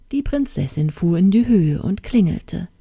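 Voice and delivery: usual speaking voice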